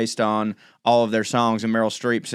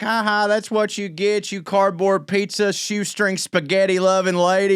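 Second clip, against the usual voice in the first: put-on taunting kid; 8 semitones higher than usual. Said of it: "he's just like".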